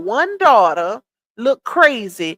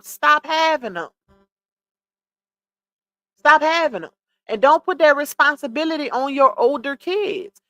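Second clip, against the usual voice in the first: usual speaking voice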